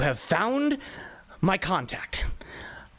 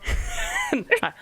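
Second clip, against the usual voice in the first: squeaky hi. Acted. Emotional angel greeting.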